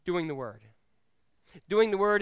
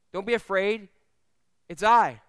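louder firm reassurance; firm call; 3 semitones higher, 8 dB louder than usual. Acted. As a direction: call out across the water. Jesus calling over storm.

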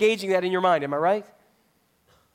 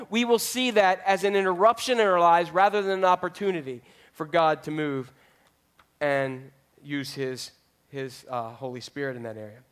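normal talking voice

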